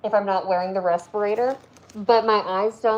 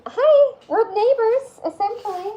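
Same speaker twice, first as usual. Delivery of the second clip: high, slow, sing-song hello; excited greeting; 13 semitones higher, 5 dB louder than usual. Real, not acted. Greeting a fellow Tennessean on stream, high excited hello. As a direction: greet the neighbor with delight.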